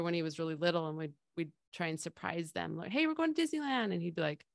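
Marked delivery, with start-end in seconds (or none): 2.9-3.9 excited announcement. Retold or imitated. Parent announcing surprise trip.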